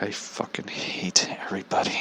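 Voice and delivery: very soft, whispered mock hatred; seething whisper. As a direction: whisper it to yourself, fed up. Acting out his seething private thought.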